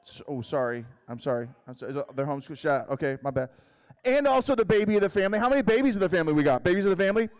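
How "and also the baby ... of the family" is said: louder, fast, higher hype; rally the room, fast and loud; calling out to the audience, pumped up; hyped crowd call. Real, not acted. Hyping up the crowd.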